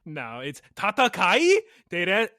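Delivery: usual speaking voice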